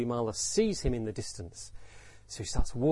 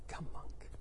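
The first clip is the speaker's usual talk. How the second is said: hushed whisper; whispered invitation; 12 dB quieter than usual. Shown as a demonstration. Buddha to new monk Angulimala, whispered invitation. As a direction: whisper it as an intimate welcome. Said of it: "how did he say them".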